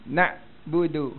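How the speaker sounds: "nak budu" slow, with a gentle "d"; soft dal. Shown as a demonstration. Teacher models the pleasing reading, soft "d" in "nak budu". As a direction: draw out "nak budu" and soften the "d". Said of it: "baru sedap".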